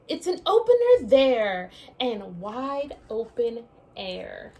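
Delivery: lively storybook read-aloud; reading to children. Acted. Teacher reading a picture book to her class, lively read-aloud. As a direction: read it out like story time for little ones.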